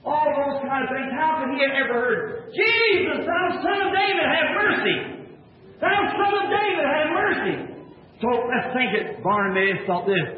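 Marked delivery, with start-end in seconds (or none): 2.5-7.6 high pleading shout across the crowd; desperate cry. Retold or imitated. Blind beggar begging Jesus for mercy, high pleading shout. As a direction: cry out so he hears you from far away.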